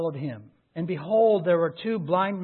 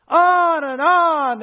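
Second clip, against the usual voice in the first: high, loud, drawn-out words; incessant; 10 semitones higher, 9 dB louder, much slower than usual. Acted. Preacher describing the blind men's endless cries, incessant. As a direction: stretch it out loudly to show endless pleading.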